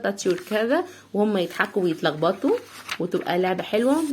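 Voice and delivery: ordinary delivery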